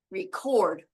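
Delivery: "record" as ree-CORD, end emphasized and falling; stress on second syllable. Shown as a demonstration. Teacher repeats the verb for learners, stress on second syllable of "record". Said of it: "but stress is different".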